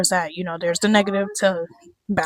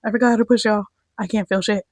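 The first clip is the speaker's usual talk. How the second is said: mock panic; 5 semitones higher, faster than usual. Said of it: "I was like".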